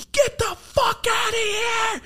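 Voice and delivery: huge high shout, drawn out slow; a big man's overjoyed roar; excited yell. Retold or imitated. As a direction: bellow it like meeting a hero. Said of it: "And he just jumps in the fucking air".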